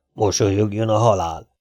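plain reading voice

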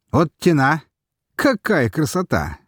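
high, loud, awestruck exclamation; amazed with a whistle. A narrator's reading. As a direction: marvel at it out loud. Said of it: "С присвистом, не ожидая от самого себя".

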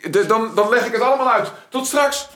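high, projected, flustered call. Acted. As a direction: call it out to the visitor, stumbling at first. Calls out to visitor outside. (gehaast en hoog naar buiten roepen)

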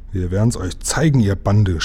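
usual speaking voice

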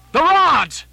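high, full-voiced shout to the crew; loud, stretched warning cry; urgent cry. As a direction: yell the alarm across a stormy deck. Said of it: "cried Starbuck to the crew".

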